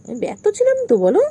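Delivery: very high squealing voice; high squeal at a cat. Real, not acted.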